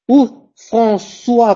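François prononcé lentement; slow François. Shown as a demonstration. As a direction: stretch François, ç as a clear s. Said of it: "je prononce comme un S".

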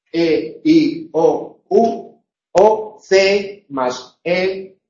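ordinary delivery

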